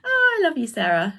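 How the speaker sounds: high, loud, affectionate gush; gushing goodbye. Real, not acted. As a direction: squeal it out with love. Saying goodbye to Sarah.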